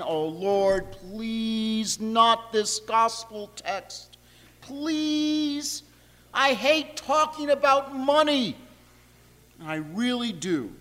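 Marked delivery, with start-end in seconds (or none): 0.0-8.6 drawn-out, pleading whine; mock whining. Preacher dreading his sermon text, whining to the Lord. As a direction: groan it out like a reluctant plea, stretching the begging words. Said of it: "mumbling and whining".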